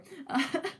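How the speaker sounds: short high embarrassed laugh; high giggle. Real, not acted. Laughing after spitting.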